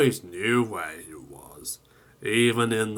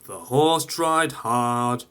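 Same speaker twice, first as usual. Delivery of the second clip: ordinary delivery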